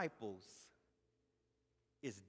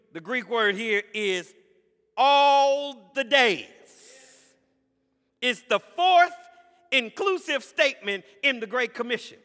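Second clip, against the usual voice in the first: preaching at full force; 9 semitones higher, 16 dB louder than usual. Acted. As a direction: hammer the promise home.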